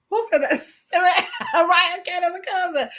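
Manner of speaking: reading through real laughter; giggling out the words; laughing. Real, not acted. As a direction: laugh while you say the names. Reading funny comment, laughing.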